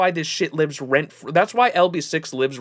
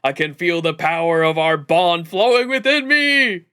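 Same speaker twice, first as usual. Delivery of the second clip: louder, hammed-up hero declaration; acted heroic cry; 3 semitones higher, 4 dB louder than usual. Streamer voices the trailer's hero feeling the bond, acted rousing cry. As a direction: build into a rousing battle cry.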